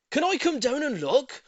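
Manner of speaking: a neighbour shouting down eagerly; shouted question. A narrator's reading. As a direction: call from the balcony above. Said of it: "Mister Hoppy shouted".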